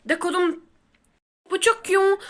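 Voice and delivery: ordinary talk, nothing marked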